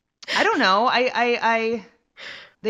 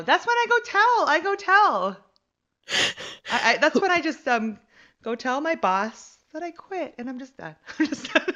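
excited; 10 semitones higher, 5 dB louder than usual. Real, not acted. Excited high joking.

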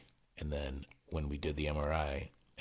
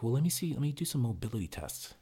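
higher, brisk mock assistant voice; imitating physician's assistant; 8 semitones higher, 4 dB louder than usual. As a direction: put on the assistant's higher, businesslike voice. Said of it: "and she's like".

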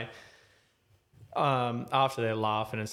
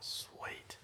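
a breathy unvoiced whisper; hushed sigh of relief; 16 dB quieter than usual. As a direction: say it on breath alone. Relieved, whispered after checking door.

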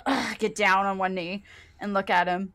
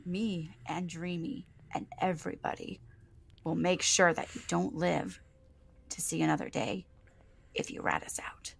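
quiet threat; 8 dB quieter than usual. Acted. Faith threatens the freed prisoner.

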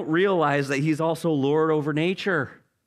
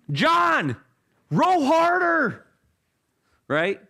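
yelling; 12 semitones higher than usual. Acted. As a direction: bark orders over the storm. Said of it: "you know, just yelling".

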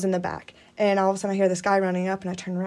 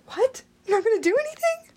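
high-pitched incredulous reaction; retold disbelief; faster than usual. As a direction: act out a baffled, high "what?", then rush the question. Retelling her disbelief at police.

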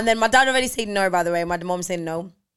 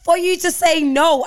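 rising, louder, slowed outrage at mum; indignant protest; 7 semitones higher, 6 dB louder than usual. Retold or imitated. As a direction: drag it out in disbelief, pitch climbing. Retelling an argument with mum.

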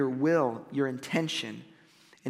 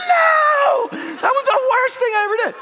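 much higher, louder yelled no, fast and laughing; mock horrified shout; 7 dB louder than usual. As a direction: shout it like she never wants to jump again. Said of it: "She's like".

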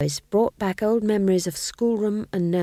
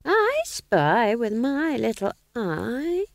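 put-on higher, very slow sing-song old woman; Nanny Og's voice; 6 semitones higher, much slower than usual. A narrator's reading. Old witch Nanny teasing the ghost king, sing-song. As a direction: drawl out the old witch's party game. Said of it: "said Nanny Og".

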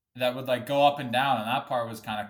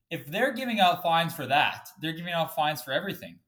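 ordinary delivery

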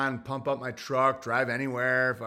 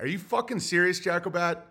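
ordinary talk, nothing marked

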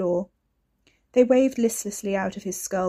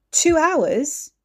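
shocked question, higher and louder; stunned at the time; disbelief; 8 semitones higher, 7 dB louder than usual. Acted.

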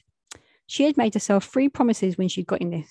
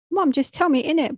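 pleading; 7 semitones higher than usual. Acted. Pleading with crying mum.

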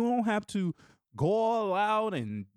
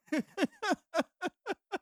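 high giggle; 12 semitones higher than usual. Real, not acted. Laughing at his son impression.

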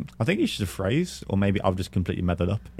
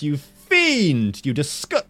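mock outrage; 5 semitones higher, 3 dB louder, much slower than usual. Mock outrage at four sugars.

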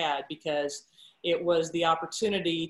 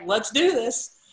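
pumped-up resolve; 5 semitones higher, 6 dB louder, slower than usual. Retold or imitated. Deciding to take the job.

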